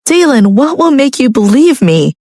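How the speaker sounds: high, anxious wife; anxious plea. A narrator's reading. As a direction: ask it worried. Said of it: "Lucia asked anxiously".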